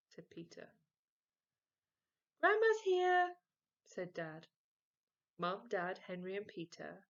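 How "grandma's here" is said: much higher and louder, announcing; calling out. Acted. Dad calling the family, loud and high. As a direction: call it through the house.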